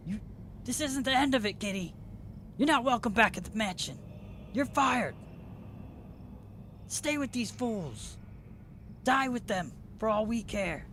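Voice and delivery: high-pitched, angry boss disowning a servant; Charn. Acted. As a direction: spit out a haughty dismissal in a high voice. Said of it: "Charn says".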